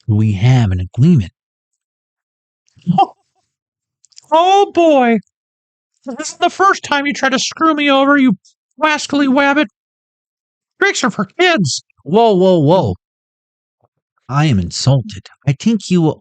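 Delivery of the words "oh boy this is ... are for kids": high comic cartoon character. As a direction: squeak it like a cartoon hero.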